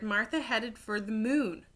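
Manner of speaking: ordinary delivery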